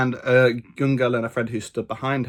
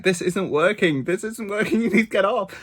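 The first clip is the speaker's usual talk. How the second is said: retold complaint; 7 semitones higher than usual. Quoting squashed man's complaint.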